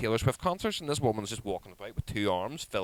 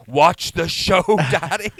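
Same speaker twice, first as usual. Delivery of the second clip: loud, high mock scolding; bossy child; 9 semitones higher, 11 dB louder, slower than usual. Daughter telling dad off at a concert, bossy. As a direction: imitate a kid ordering Daddy about.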